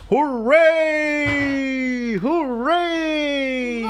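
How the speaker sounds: high, drawn-out cheering hooray; yelled. Acted. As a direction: cheer like a parade crowd.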